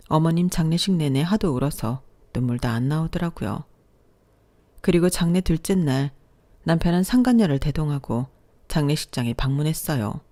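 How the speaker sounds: normal talking voice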